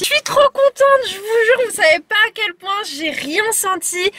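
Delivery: high, thrilled and happy; excited. Real, not acted. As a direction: gush with relief. Thrilled after a painless appointment.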